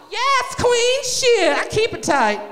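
high, loud sassy diva; talking back to the doctor in a high, sassy diva voice; sassy queen voice. Retold or imitated.

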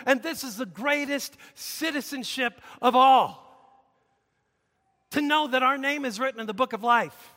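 forceful sermon voice building to a shout; impassioned preaching. Real, not acted. Preacher tells congregation God forgives sinners, impassioned. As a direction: proclaim it to the congregation with rising fervour.

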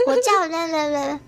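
put-on mumbling child. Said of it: "然后就很小声".

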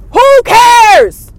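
high, very loud exasperated yell; big scornful outburst; dismissive shout. Real, not acted. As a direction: holler it like you're utterly fed up. Dismissing her complaint of being shot.

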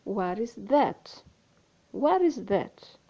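slow, "What is that?" drawn out; slow model question; slow demo. Shown as a demonstration. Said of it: "a pergunta é".

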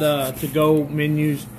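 normal talking voice

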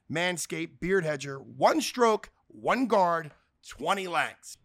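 loud, punchy sponsor pitch; ad read. Acted. Host reading the razor ad to listeners, upbeat and loud. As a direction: sell the promo code hard.

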